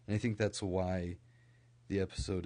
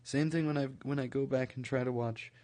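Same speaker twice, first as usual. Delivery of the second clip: normal talking voice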